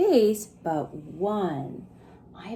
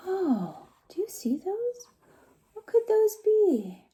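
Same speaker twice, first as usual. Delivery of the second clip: high, soft wondering voice; curious Digger; 11 semitones higher, 3 dB quieter than usual. Acted. Digger finds seeds in the rubble, high and soft. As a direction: gently marvel as little Digger. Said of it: "he said".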